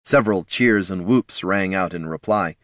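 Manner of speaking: usual speaking voice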